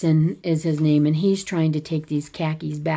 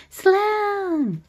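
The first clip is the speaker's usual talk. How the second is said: high, drawn-out call. Retold or imitated. Mom opening the door on her daughter, high drawn-out call. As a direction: sing out the name, long and high.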